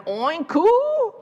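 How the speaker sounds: high oink with a cooing moo tail; nearly oink, then slide up into oo; pig's failed oink. A narrator's reading. Storyteller as pig trying again, high muddled oink-moo. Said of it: "And again".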